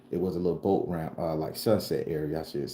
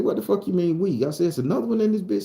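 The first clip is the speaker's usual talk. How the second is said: high, louder, agitated; alarmed outburst; 12 semitones higher, 5 dB louder, faster than usual. Retold or imitated. Boy learning of a second snake, alarmed. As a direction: blurt it out in high-pitched alarm.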